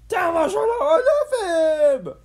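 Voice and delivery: long, high, wailing scream. A narrator's reading. Sheen tumbling down the mountain, screaming. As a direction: scream it out as if falling. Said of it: "Sheen screamed as he fell".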